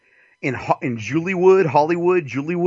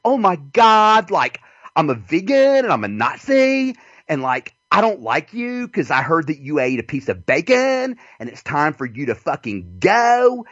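Cyanide; 4 semitones higher than usual. Retold or imitated. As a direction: stretch the last words in a higher mocking voice. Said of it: "trying to do my cyanide".

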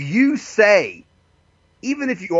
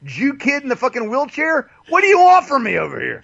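high-pitched comic protest, incredulous; mock exasperation; 10 semitones higher than usual. Guest retells his reaction to the host, mock exasperation. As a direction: rant in a much higher voice, hammed-up.

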